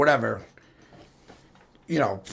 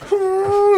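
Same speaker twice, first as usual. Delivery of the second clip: a very high, tense hum; high nervous hum; 4 dB louder than usual. Retold or imitated. Nervous driver humming.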